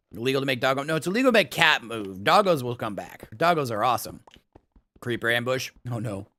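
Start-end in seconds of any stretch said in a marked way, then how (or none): none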